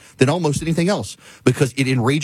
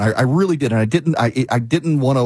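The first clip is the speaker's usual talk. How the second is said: normal talking voice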